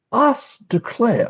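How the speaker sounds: slow Southern drawl, high and airy; a Southern belle-ish I declare; Southern gentleman accent. Retold or imitated. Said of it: "Kenneth Branagh was playing".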